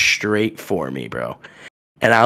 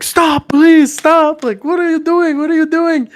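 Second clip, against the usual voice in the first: much higher, louder pleading voice; a frantic bystander pleading over a street fight, much higher; high pleading peacemaker; 5 dB louder than usual. Retold or imitated. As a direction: beg them to stop in a thin high voice. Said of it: "he's like".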